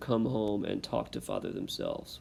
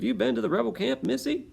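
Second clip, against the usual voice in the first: much higher, fast soldier voice; wrong voice; 13 semitones higher, 5 dB louder than usual. Acted. First try at the redcoat questioning Dicey, high squeaky voice. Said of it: "totally the wrong voice for that".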